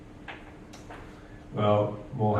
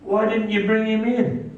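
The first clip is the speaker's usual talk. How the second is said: high, indignant demand; 11 semitones higher than usual. A narrator's reading. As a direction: voice the priests demanding answers.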